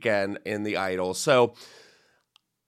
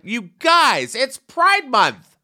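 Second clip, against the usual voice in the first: exasperated; 9 semitones higher, 7 dB louder, much slower than usual. Retold or imitated. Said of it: "I was like".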